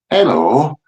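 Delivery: put-on northern English hello; northern prank-call voice, rising at the end; northern accent. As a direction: greet in a mock northern accent. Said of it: "put on the northern accent".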